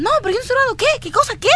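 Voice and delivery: high-pitched mock indignation; indignant denial. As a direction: imitate an accused girl protesting her innocence. Accused girl's indignant denial, retold.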